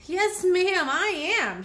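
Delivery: cheerful high little bee; happy reply. A narrator's reading. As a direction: answer eagerly and high. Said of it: "she replied".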